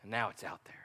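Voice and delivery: the preacher lets the moment land, whispered. Real, not acted. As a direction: let it out in a whisper. Hushed pause after the plea.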